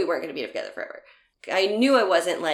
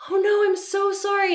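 high-pitched, louder mock sympathy, oh no; high sympathetic gasp; 5 dB louder than usual. To crying ex about his mother, high sympathetic oh no. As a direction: pour out big sympathy, pitched way up. Sympathetic reply to bad news.

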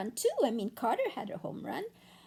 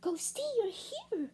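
put-on girl's voice, much higher and softer; a little girl, higher and hushed; high child voice; 7 semitones higher, 6 dB quieter than usual. Amelia surprised to find Ghosty, high and soft.

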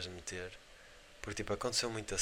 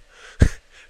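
loud laugh; 20 dB louder than usual. Real, not acted. Laughing while retelling.

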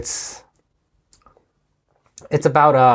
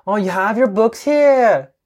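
higher, lively imitation of a Colombian Spanish accent; imitating a Colombian accent; 10 semitones higher than usual. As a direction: play Gloria-style Colombian English. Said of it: "I can imitate it". (コロンビア訛りの英語を高めの声で真似る)

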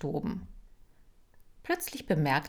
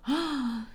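shocked gasp; 8 semitones higher than usual. Acted. Shocked at the theft.

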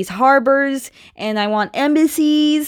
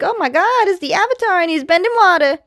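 higher, hurried mock amazement; excited onlookers; 6 semitones higher, faster than usual. Girls spot the Avatar bending water, excited. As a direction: imitate starstruck kids, pitched up.

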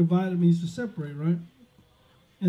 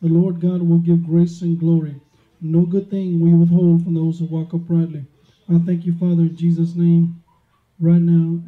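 loud, fervent preaching prayer; 7 dB louder than usual. Real, not acted. Preacher opening the sermon with loud fervent prayer. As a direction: pray it out loud and strong.

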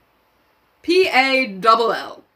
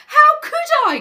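put-on much higher, louder indignant boy; high indignant schoolboy; indignant Piggy cry; 6 dB louder, faster than usual. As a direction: protest at the accusation. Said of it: "cried Piggy indignantly".

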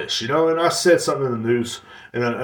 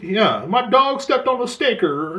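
much higher, slow, whiny mock voice; mocking Amber Heard; 8 semitones higher, slower than usual. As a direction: parody her testimony, pitched way up and dragged out. Mocking testimony, continued.

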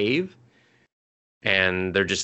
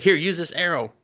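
higher, brightly helpful; offering an arrow; 9 semitones higher than usual. Retold or imitated. Companion tossing elf an arrow, higher eager offer. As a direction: hand it over eagerly. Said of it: "He's like".

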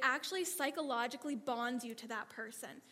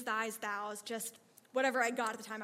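ordinary talk, nothing marked

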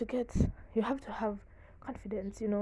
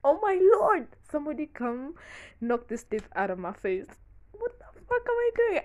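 panicked inner voice; 7 semitones higher, 6 dB louder than usual. Retold or imitated. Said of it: "I was just like".

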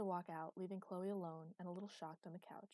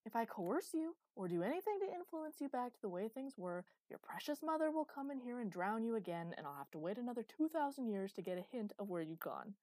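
louder, higher exasperated explanation; heated retort; 5 semitones higher, 6 dB louder than usual. A narrator's reading. Master warning Chloe about her precious mother, heated. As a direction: argue back forcefully, voice raised.

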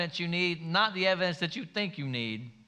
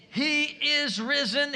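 He is risen, proclaimed higher and louder, slow; 8 semitones higher, 4 dB louder, slower than usual. Shown as a demonstration. Pastor proclaims the resurrection to the church, loud and slow. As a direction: ring it out like an Easter proclamation. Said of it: "Here's those three words".

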